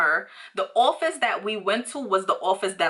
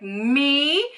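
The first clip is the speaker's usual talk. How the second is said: surprised; 7 semitones higher, 5 dB louder than usual. Retold or imitated. Surprised to be called out.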